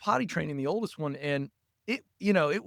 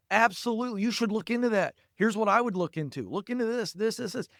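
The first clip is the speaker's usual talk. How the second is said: higher-pitched, mildly eager encouragement; encouraging parent; 6 semitones higher than usual. Acted. Parent backing a child's idea, acted eager encouragement. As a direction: cheer the idea on, pitch lifted.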